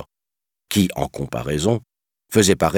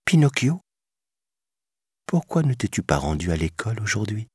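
fée tendre, plus douce à la fin; voix douce; 6 semitones higher than usual. A narrator's reading. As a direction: questionne avec douceur. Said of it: "demanda-t-elle d'une voix douce". (douce voix de fée)

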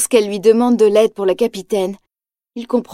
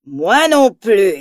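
slow cavernous menacing voice; pit fiend; much slower than usual. A narrator's reading. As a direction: loom out of the dark, slow. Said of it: "une voix caverneuse monte de l'obscurité".

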